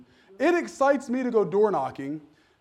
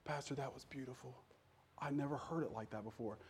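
hushed, much softer quote of a moved soldier; quietly overcome, imitating the man; 5 semitones lower, 17 dB quieter than usual. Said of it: "the man from the military is sobbing".

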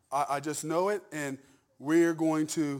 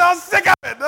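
high, loud shout breaking into laughter; laughing mock shout; 14 semitones higher, 16 dB louder than usual. Pastor repeating a congregant's outburst through laughter. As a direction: mimic the fed-up outburst while cracking up. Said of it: "She said".

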